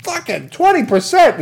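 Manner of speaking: high, loud indignant squawk; mock outrage. Homeowner hearing the interest rate, high outrage. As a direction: exclaim it way up high in disbelief.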